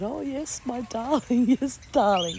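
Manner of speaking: high, laughing mock endearment; laughing through mock sweetness; laughing reply. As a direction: play along while laughing. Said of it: "I kinda laughed and said".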